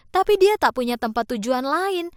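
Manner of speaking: ordinary delivery, nothing marked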